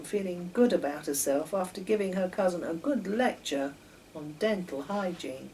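ordinary delivery

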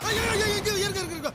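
alarmed pleading. Acted. Pleading as he is threatened. (பதறிக் கெஞ்சும் குரல்)

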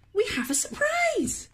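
high, bright teasing reveal; excited surprise. A narrator's reading. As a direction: sing it out as a surprise. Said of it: "his parents said".

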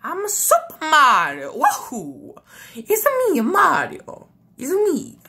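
high, bouncy Italian plumber voice; Mario voice. Pretend. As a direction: cheer it out like Mario. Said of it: "we have Mario".

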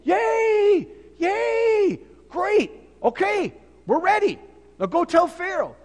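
high, loud cheering "Yay! Yay!"; excited crowd. Retold or imitated. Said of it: "the people are all excited".

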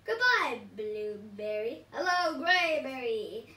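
lightly voiced gloating villain; gloating villain pea. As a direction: sneer at each veggie in turn. Villain Pea taunting veggies.

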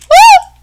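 piercingly high "woo"; high loud whoop. Real, not acted. Whoops mid-fight.

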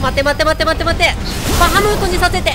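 loud, urgent gamer panic; rapid-fire repeated words, loud and worked up; over-excited. Real, not acted. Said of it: "私がね、興奮しすぎて". (興奮して大声で焦る)

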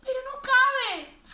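high, loud dismayed exclaim; surprised. A narrator's reading. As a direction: exclaim he doesn't fit. Bear doesn't fit through door.

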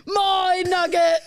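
loud, slow, laughing greedy cries; greedy child shouting. Retold or imitated. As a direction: shout like a kid grabbing food. Greedy child grabbing nuggets.

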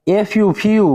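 "এফ ইউ" বানান, তারপর "ফিউ" টেনে বলা; "ফিউ"-তে লম্বা ইউ ধ্বনি; ইউ উচ্চারণ দেখানো. Shown as a demonstration. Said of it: "ইউ উচ্চারণ করতে হচ্ছে". (শিক্ষকের মতো "এফ ইউ, ফিউ" ভেঙে বলা)

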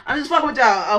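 high laugh through words; laughing off the joke. Real, not acted.

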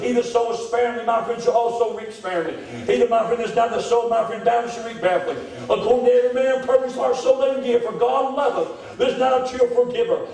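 high, ringing sermon recitation; preached scripture reading. Acted. Preacher reading Corinthians to the church, proclaiming. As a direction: proclaim the verse from the pulpit.

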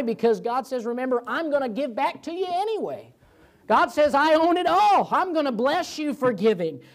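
high, rising preacher's proclamation; fervent preaching. Real, not acted. Preacher promising the congregation God's return, fervent. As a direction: drive the promise home to the congregation.

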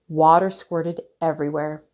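ordinary delivery

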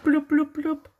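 very high cartoon voice; a high squeaky voice, shocked at being a cat. Character turned into cat.

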